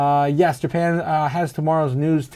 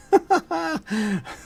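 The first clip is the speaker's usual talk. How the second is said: high burst of laughter; 11 semitones higher than usual. Real, not acted. Laughs at chat quote.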